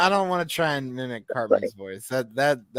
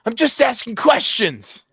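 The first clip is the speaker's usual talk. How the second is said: high, slow cartoon kid whine; a hint of a whiny cartoon boy; Eric Cartman voice; 7 semitones higher, 5 dB louder, slower than usual. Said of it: "my best Eric Cartman voice".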